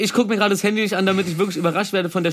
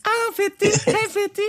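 squeaky falsetto greeting; high squeaky voice; 14 semitones higher than usual. Pretend. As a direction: call out the name in a high falsetto. (hohe Quietschstimme)

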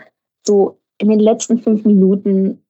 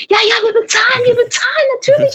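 very high, eager agreement; eager pleading; faster than usual. Retold or imitated. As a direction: gush that of course they'll pay. Crew eagerly agreeing to pay. (sehr hoch und eifrig)

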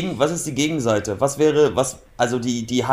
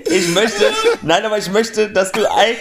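high, louder through laughter; laughing; 5 semitones higher, 4 dB louder, slower than usual. Real, not acted. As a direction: talk while cracking up. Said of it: "Ist das dumm".

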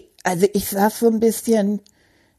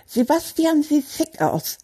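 usual speaking voice

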